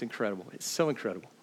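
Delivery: awed near-whisper; hushed awe. Real, not acted. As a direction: breathe it out in wonder. Awed aside about tiny letters.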